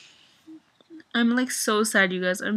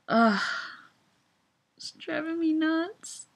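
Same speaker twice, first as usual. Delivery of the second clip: much higher, slow strained whine; frustrated wail; 9 semitones higher than usual. Real, not acted. Fan venting to viewers, high slow frustrated whine. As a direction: whine it out at the top of your voice.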